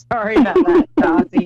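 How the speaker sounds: loud, high, laughing sorry; laughing apology. Real, not acted. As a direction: apologise through giggles at your own slip. Laughing over her accent mix-up.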